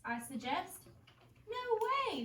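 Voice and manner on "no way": high, mocking no way; kids refusing. Friends at recess turning Marisol down, high refusal. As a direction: toss off a refusal. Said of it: "they say".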